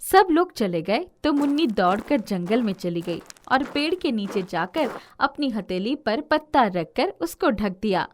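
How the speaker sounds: ordinary delivery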